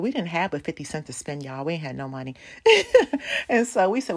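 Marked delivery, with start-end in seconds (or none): 2.4-3.4 high, loud burst of laughter; laughing. Real, not acted. Speaker admits they had no money, laughing.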